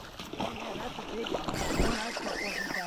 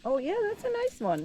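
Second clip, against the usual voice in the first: excited; 12 semitones higher, 7 dB louder than usual. Real, not acted. Excited reaction to big fish.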